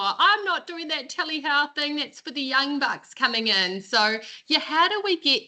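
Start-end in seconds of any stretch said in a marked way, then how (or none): 0.0-3.8 put-on high, dismissive older doctor; grumpy old GP. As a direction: scoff at the new tech. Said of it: "a G P in our practice that's like".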